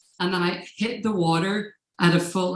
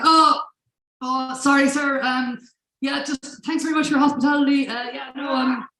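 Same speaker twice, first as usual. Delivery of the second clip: high-pitched, hurried, awkward apology; flustered politeness; 8 semitones higher than usual. Retold or imitated. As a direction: babble polite excuses to get away. Caught leaving, makes awkward polite excuses.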